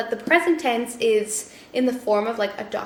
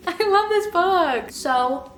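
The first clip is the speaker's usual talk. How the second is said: gushing; 6 semitones higher, 3 dB louder, slower than usual. Real, not acted. Gushing about a five-star read.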